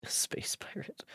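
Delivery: breathy whispered aside. Real, not acted. Streamer whispers a joke to chat.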